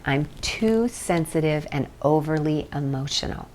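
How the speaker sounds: softly voicing the setup statement to yourself; quiet self-statement. Shown as a demonstration. Teacher models the setup statement for viewers, softly. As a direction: say it low and inward, like a private confession. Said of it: "Say that to yourself right now".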